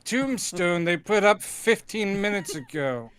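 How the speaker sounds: hammed-up actor introducing a film; James Mason. Presenting a fake tombstone, mock James Mason. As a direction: put on a theatrical host's voice. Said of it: "Mason impressions".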